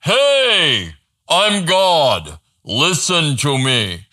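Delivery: a mock proclamation from the sky, slowing at the end; a hint of God thundering down from heaven; booming voice of God. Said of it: "Does a rumbling voice from heaven have to say".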